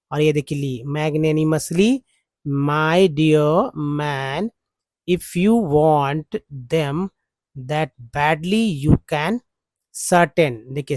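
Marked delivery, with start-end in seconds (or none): 0.9-10.5 very slow, word-by-word reading of the text; slow dictation reading. Shown as a demonstration. Teacher reading the English passage slowly for learners. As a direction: spell the sentence out one word at a time.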